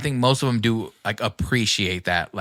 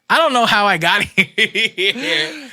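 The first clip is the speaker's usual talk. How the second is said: high, loud line dissolving into laughs; breaking into laughter; 8 semitones higher, 5 dB louder, slower than usual. Retold or imitated. Host admitting he lost his thread, breaking into laughter. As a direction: laugh your way slowly through the last words.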